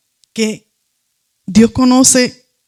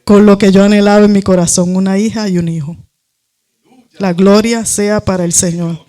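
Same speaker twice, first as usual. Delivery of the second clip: ordinary talk, nothing marked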